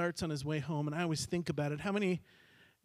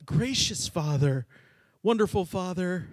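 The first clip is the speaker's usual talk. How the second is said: buttering up; 2 semitones higher, 6 dB louder than usual. Acted. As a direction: lay the flattery on thick, word by word. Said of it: "How do I butter him up?".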